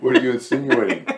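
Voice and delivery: mock-offended, laughing loudly. Real, not acted. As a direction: laugh through a mock accusation.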